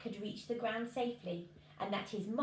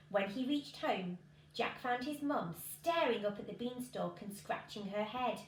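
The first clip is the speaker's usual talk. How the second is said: ordinary delivery, nothing marked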